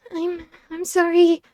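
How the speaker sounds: slow tearful stammered sorry; tearful choked apology. A narrator's reading. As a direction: force the apology through tears. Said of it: "My voice was choked by tears".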